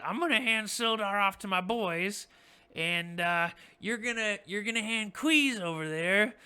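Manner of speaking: goblin boss. Acted. Goblin boss dictating the trade terms, loud and high. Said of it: "And he says".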